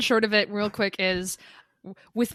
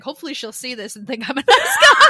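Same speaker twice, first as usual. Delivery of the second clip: laughing; 2 semitones higher, 9 dB louder than usual. Retold or imitated. Heathcliff scheming, laughing.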